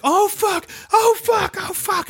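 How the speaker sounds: put-on very high, tiny kid voice; little voice. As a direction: imitate a small kid in shock, way up high. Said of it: "it's this little voice".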